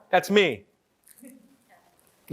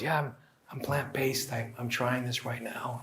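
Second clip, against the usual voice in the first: hushed, timid confession, much softer; imitating a whisper; 7 semitones lower, 13 dB quieter than usual. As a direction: mimic someone confessing in a near whisper. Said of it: "They're literally whispering to me".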